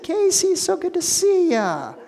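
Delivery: high, bright-pitched happy hello; excited greeting. Retold or imitated. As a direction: greet an old friend with delight. Greeting friend, retold.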